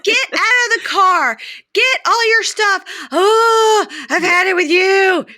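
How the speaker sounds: high, loud, slow screeched orders; mock furious yell. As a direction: imitate a girlfriend at the end of her rope. Girlfriend throws him out.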